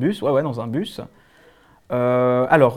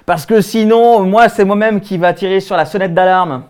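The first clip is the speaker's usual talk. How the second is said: loud, raised-pitch warning; indignant threat; 3 semitones higher, 6 dB louder than usual. Acted. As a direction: announce the threat with self-important outrage. Menace de tirer l'alarme. (menace indignée)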